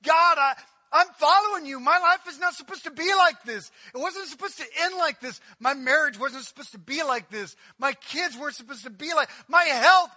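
high, loud pleading cry, near breaking; anguished believer at the cross; confused believer. Acted. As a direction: pour out confusion to God. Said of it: "you're going".

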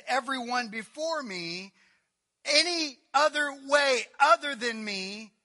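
loud, high preaching call; full-force preaching. Acted. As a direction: drive it home. Jesus's words, preached loudly.

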